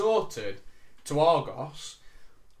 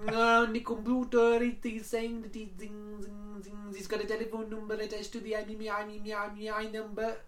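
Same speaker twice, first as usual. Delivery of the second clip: imitated Indian accent; 7 semitones higher than usual. Agent voiced in phone story.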